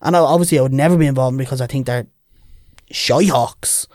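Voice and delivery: usual speaking voice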